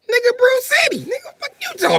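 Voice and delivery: high, loud outraged shout; mock indignant yell. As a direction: yell in disbelief at the riddler. Outraged at the riddle.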